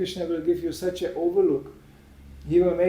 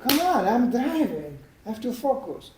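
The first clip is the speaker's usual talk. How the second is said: acted exasperation, pitched up; exasperated driver; 6 semitones higher than usual. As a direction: plead with a distracting passenger. Driver pleads with the passenger.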